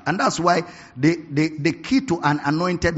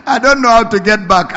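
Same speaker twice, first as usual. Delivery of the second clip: laughing, louder; 6 semitones higher, 10 dB louder than usual. Retold or imitated. Preacher repeating his friend's lament to the congregation, louder and laughing.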